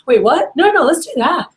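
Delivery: high, hurried change of mind; retold startled, eager reply; surprised eagerness. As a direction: imitate a customer suddenly jumping at the deal. Retold customer suddenly changing her mind.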